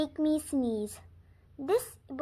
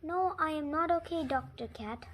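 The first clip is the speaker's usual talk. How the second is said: usual speaking voice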